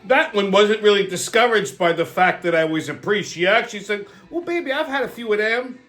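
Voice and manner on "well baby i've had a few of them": a light, higher sultry hooker voice; hooker's come-on. Retold or imitated. Hooker teasing the self-declared priest, lightly voiced higher come-on.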